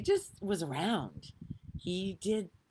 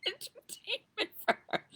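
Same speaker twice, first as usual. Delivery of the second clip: laughing. Real, not acted. Laughing at the idea.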